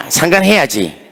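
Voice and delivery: ordinary talk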